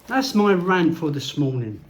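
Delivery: normal talking voice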